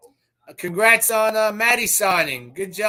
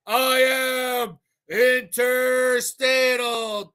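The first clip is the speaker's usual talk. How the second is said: drawn-out catchphrase shout; 3 semitones higher, much slower than usual. Acted. Host greeting a viewer with his catchphrase, stretched shout. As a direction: stretch every syllable like a ring announcer.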